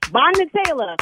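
high, loud exclamation; real shocked outcry. Real, not acted. Victim learning friend set her up, shocked outcry. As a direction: cry out the culprit's name in disbelief. Reacts to prank reveal.

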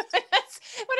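said through laughter; laughing. Real, not acted. Laughing while explaining.

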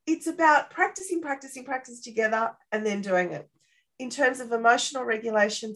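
ordinary talk, nothing marked

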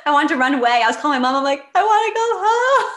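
high, loud, half laughing, half crying; laughing through tears. Real, not acted. Laughing through tears as she recalls calling her mom. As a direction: crack up and tear up at once. Recalling wanting to run away.

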